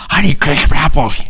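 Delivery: high, garbled put-on impediment; mock impediment with slurred, distorted sounds; speech impediment. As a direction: mangle the consonants on purpose to annoy. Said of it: "is a speech impediment".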